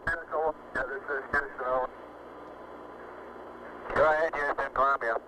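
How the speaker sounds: crackly radio transmission; distant voice over a space radio link; astronaut radio. Real, not acted.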